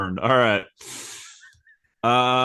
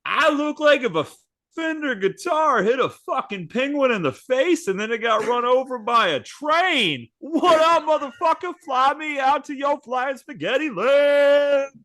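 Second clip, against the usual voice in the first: much higher, squeaky brash voice; Angel Reese voice; 12 semitones higher than usual. Retold or imitated. Angel Reese bragging to the monster, very high and brash. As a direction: squeal it out, cocky. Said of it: "She goes".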